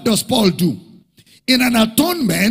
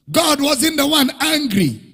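louder, rising preacher cadence; full-force preaching; 4 semitones higher, 5 dB louder than usual. Acted. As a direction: drive the point home to the congregation.

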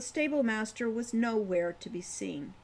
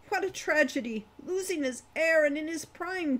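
put-on higher, louder old dwarf with a touch of sorrow; old dwarf, sad; 6 semitones higher, 4 dB louder than usual. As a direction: mourn the Baron's family as the old dwarf. Wolfgang tells sad family story.

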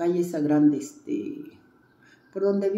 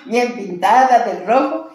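slow and louder, laughing while describing herself; 3 semitones higher, 7 dB louder than usual. Real, not acted. Describing her red dress and lipstick to the interviewer, laughing. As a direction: chuckle at the memory of being all in red.

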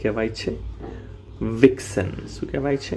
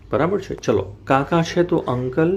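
normal talking voice

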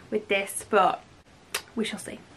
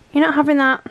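louder, firm telling-off; firm no to the dog; 4 semitones higher, 7 dB louder, faster than usual. Real, not acted. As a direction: put your foot down with the dog. Dog reaching for plate.

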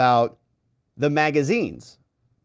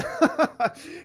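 high airy laugh; breathless laugh; 12 semitones higher than usual. Real, not acted. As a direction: laugh in disbelief. Laugh after weekend deadline joke.

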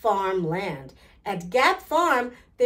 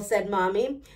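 ordinary delivery

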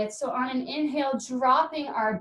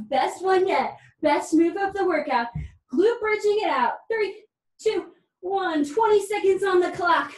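upbeat coach; 6 semitones higher, 5 dB louder than usual. Real, not acted. Coach counting down hype.

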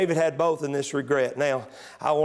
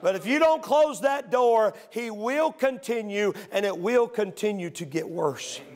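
preaching; 5 semitones higher than usual. Acted. Preacher at full force.

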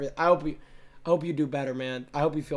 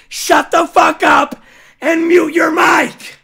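slow furious yell; 8 semitones higher, 10 dB louder, slower than usual. Real, not acted. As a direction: bellow each word slowly, much louder and higher. Ordering teammate to shut up.

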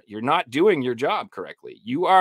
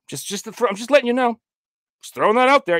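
mock helpful reminder; 10 semitones higher, 4 dB louder, much faster than usual. Acts out an unwanted moral reminder.

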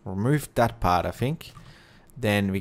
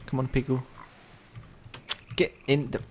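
normal talking voice

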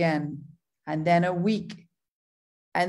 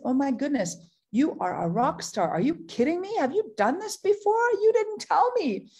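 excited; 6 semitones higher than usual. Retold or imitated. Praising a new partner, thrilled and rising in pitch. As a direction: gush with growing disbelief at his message. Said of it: "I was so excited".

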